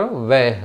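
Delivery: low "వెహ్", va turned into ve; colloquial "వెహ్". Shown as a demonstration. Teacher answering what they say, "వెహ్" as veh. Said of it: "వాని వేగా పలుకుతారు".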